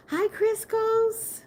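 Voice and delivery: very high, drawn-out hello; high and stretched-out greeting; sing-song greeting. Real, not acted. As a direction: greet a viewer in a long, high coo. Greeting a viewer who just arrived.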